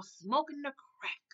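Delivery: slow, soft, drawn-out "Smoking the crack"; slow drawn-out repeat. Shown as a demonstration. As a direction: stretch "Smoking the crack" out slowly and softly for effect. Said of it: "He is smoking the crack".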